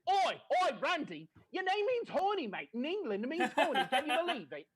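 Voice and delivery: loud, high Cockney-ish British woman; British impression. Comic doing his mum's British girlfriend loudly in a British accent. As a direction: belt it out like a brash British broad. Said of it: "Your Irene impression was a dead on".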